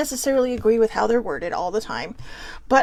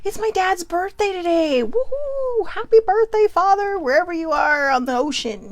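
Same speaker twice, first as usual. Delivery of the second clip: excited birthday cheer; 7 semitones higher than usual. Real, not acted. Birthday greeting to dad.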